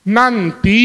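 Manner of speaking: put-on curt, clipped-off "Nanti." to the child; irritated reply. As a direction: shut the nagging down, losing patience. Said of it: "Nah, mulai".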